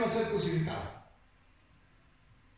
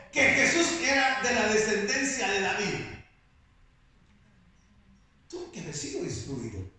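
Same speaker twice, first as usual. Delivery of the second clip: normal talking voice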